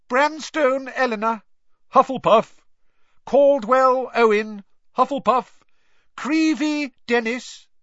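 high, loud roll-call shout. A narrator's reading. Names and houses called at the sorting, high and loud. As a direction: call each one across the hall.

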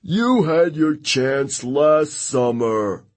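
high, drawn-out jeer; heckling yell. A narrator's reading. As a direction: jeer at a rival from the crowd. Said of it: "yelled one of the Ares campers".

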